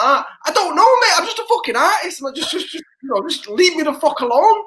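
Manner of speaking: exasperated brush-off. Retold or imitated. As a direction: wave the reporter off, exasperated. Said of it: "And I'm like".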